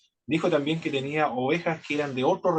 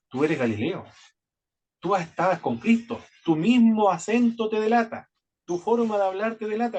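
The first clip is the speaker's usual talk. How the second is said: rising, louder accusation of Peter; accusing bystanders; 7 semitones higher, 3 dB louder than usual. Retold or imitated. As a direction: point the finger, voice climbing higher. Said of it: "le dijeron". (acusando a Pedro, la voz subiendo más aguda)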